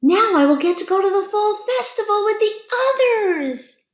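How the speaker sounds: high cheerful little pumpkin; happy little pumpkin. A narrator's reading. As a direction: glow with relief. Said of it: "he smiled to himself".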